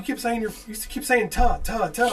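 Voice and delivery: high, loud, repetitive complaint; puzzled Southern guy. Retold or imitated. Southern caller repeating the odd word, mock exasperation. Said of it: "It's like from Southern guy".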